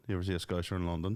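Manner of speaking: ordinary delivery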